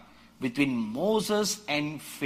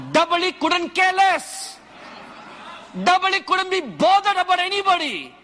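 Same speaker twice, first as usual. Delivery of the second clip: much higher, louder shouted preaching; full-force preaching; 7 semitones higher, 5 dB louder than usual. Acted. Preacher rousing the congregation about a wild ox, shouting. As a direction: thunder it at the congregation.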